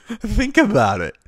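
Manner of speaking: high, loud and slow; emphatic. Real, not acted. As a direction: drive the point home, loud. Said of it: "ranting about the saying".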